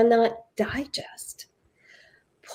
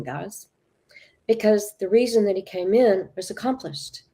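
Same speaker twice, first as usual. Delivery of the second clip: usual speaking voice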